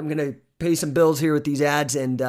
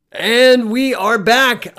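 loud, slow, much higher announcer call; loud slow return from the break; big host comeback; 9 semitones higher, 11 dB louder, much slower than usual. Real, not acted. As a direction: stretch it out like a show opener.

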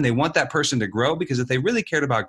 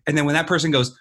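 ordinary talk

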